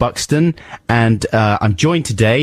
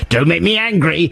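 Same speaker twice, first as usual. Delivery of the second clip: higher mock Hulk warning; Hulk line, hammed up; 8 semitones higher than usual. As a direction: ham up the Hulk threat. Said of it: "It's like the Incredible Hulk".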